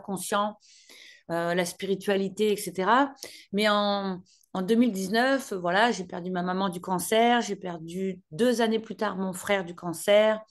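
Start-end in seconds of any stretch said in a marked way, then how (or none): none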